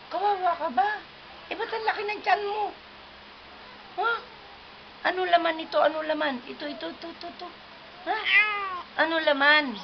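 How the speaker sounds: put-on high, sing-song baby talk to a toddler; teasing a toddler about her big tummy, high and sing-song. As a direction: coo at the little one playfully. Grandma baby-talks to toddler.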